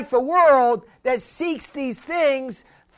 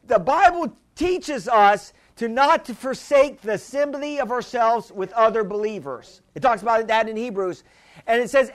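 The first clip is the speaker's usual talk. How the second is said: usual speaking voice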